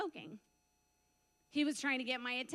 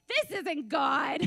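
slow, louder denial through a laugh; retold out-loud protest; 8 dB louder, much slower than usual. Believer talking back to God, laughing disbelief. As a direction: protest it slowly, laughing at yourself. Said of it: "I said out loud back to him".

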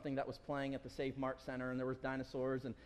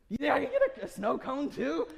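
laughing request; 10 semitones higher, 8 dB louder than usual. Retold or imitated. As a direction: ask through a laugh, half embarrassed. Son asking for more, laughing.

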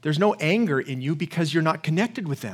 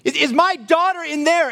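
higher, desperate plea from a frantic father; a preacher's mildly acted yell, pitched up; retold frantic scream; 8 semitones higher, 3 dB louder than usual. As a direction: cry it out like a father at the police line. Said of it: "he starts screaming".

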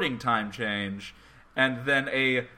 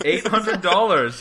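incredulous; 4 semitones higher, 7 dB louder than usual. Real, not acted. Host reacts to a car's price.

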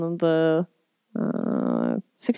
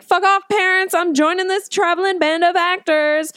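defiant retort; 10 semitones higher than usual. Retold or imitated. Daughter defies her parents.

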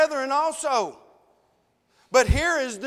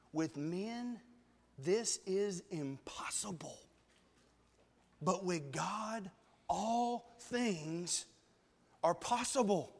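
hushed reverent voice; 5 semitones lower, 11 dB quieter, slower than usual. Acted. Preacher quoting Jesus to the crowd, hushed and slow. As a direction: drop to a near whisper, slow and soft. Jesus's quiet reply in scripture.

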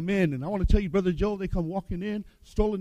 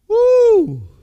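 joyful shout; 10 dB louder than usual. Real, not acted.

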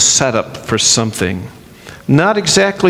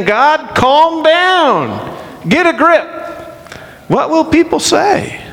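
much higher, mock-urgent plea to God; hammed-up Moses begging God to get a grip; Moses exasperated; 10 semitones higher than usual. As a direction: pastor acting Moses scolding an angry God, much higher. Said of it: "Moses is saying".

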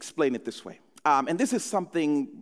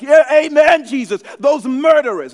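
much louder, higher mock amen shout; judgmental churchgoer; 7 semitones higher, 11 dB louder than usual. Pastor mocking judgmental church folks, loud mock shout. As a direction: ham up a self-righteous cheer. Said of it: "we even become super judgmental".

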